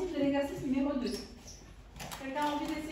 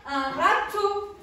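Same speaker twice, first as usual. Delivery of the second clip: râteau drawn out slow and louder; stretched word; 3 semitones higher, 5 dB louder, much slower than usual. Shown as a demonstration. Teacher to pupils: slow, loud râteau. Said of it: "Regardez comment on écrit".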